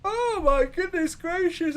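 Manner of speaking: said through a big yawn. Real, not acted. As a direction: yawn while speaking, slow and drawn. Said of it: "I'm yawning so much".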